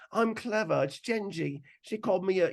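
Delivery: a bit of the English bloke voice; British accent. Retold or imitated. Comic voicing the English guy bit in a British accent. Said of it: "that's a good British accent".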